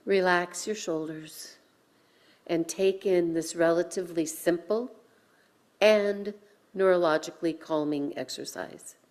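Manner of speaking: calm breathing instructions with long pauses; guided breathing. Acted. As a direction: guide the congregation gently through each breath. Leads breathing practice.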